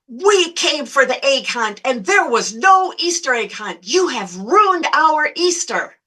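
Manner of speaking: mock-outraged whine, stretched at the end; indignant complaint. Acted complaint of the irate mother.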